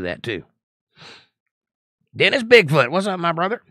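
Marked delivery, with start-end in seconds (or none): 2.2-3.6 excited greeting. Real, not acted. Greeting a viewer by name.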